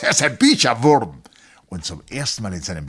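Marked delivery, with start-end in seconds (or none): 0.0-1.2 higher, loud teasing call; hearty call. A narrator's reading. Colonel boasts about the doctor to the officers, loudly. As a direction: call it out proudly. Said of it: "rief der Oberst".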